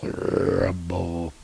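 low, slow rolled "rumble"; imitated rumble. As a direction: roll the r long like distant thunder. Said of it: "The sound effect is rumble".

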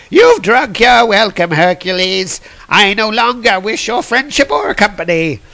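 high, put-on character voice; indignant wine god. Dionysus disowning his drinking companion, high indignant voice. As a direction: pitch it up and declaim the dismissal.

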